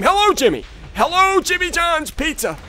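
high, teasing hail; excited taunting call. Real, not acted. Gamer hailing Jimmy in battle, taunting. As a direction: sing out to your target.

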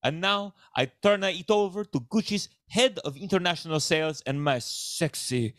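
hammed-up Italian patriarch accent; broad stage Italian accent; cartoonish Italian accent. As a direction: make a proud announcement in a broad fake Italian accent. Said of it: "speaks with an accent that".

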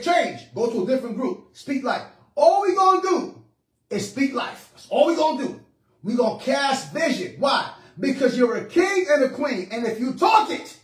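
full-force preaching. Real, not acted. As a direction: preach it with rising, punchy emphasis.

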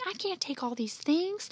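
high-pitched overwhelmed objection; cried protest. A narrator's reading. Andy overloaded with errands, crying out in protest. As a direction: cry out that it's too much. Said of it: "cried Andy".

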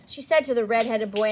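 ordinary delivery

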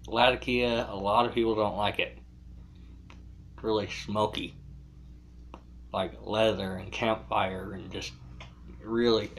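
talking around pipe stem; pipe in mouth. Real, not acted. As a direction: teeth clenched on pipe. Seen 4: Pipe stem clenched in mouth throughout while talking and gesturing.

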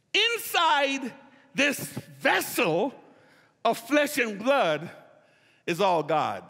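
slow, weighty phrasing; solemn slow build. Real, not acted. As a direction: let each phrase land, awed. Preacher slows for climax.

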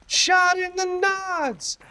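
high, slow, silly squeak; high slow mock voice. Shown as a demonstration. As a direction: stretch it out in a squeaky falsetto. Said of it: "That guy got shot".

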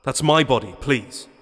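loud, high pleading; desperate plea. Acted. Desperate plea after shout.

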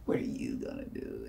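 very low, soft taunt; mock low challenge. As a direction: imitate a kid quietly daring someone. Acted challenge from the kid.

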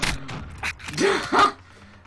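loud, high-pitched laugh; big helpless laugh. Real, not acted. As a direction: burst out laughing at absurd bad luck. Laughs at the game.

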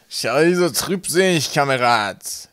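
loud, high, drawn-out creature voice; grinning gnome. A narrator's reading. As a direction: play a cheeky gnome, slowly and loudly. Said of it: "das grinsende Gesicht eines Pöbelgnoms".

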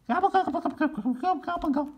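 very high gobbling; gobbling turkey. Acted. Hidden turkeys gobbling at the pilgrims, very high.

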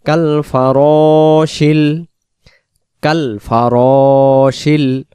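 slow chanted কাল ফারাশিল; slow recitation. Shown as a demonstration. Teacher models কাল ফারাশিল in slow recitation. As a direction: recite কাল ফারাশিল slowly with long vowels. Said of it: "ফারাশিল না".

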